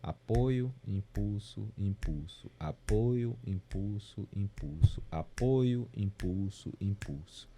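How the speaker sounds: even chant in threes, no punched beat; flowing ternary count. Shown as a demonstration. Music teacher demonstrating ternary flow, unaccented support and impulses. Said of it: "vai sentir a mesma coisa".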